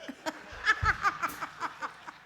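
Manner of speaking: breathy high-pitched laughter; high cackling laugh. Real, not acted. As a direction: laugh hard at your own punchline. Laughing at her own joke.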